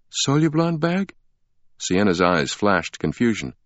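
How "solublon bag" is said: confused question. A narrator's reading. Sienna confused.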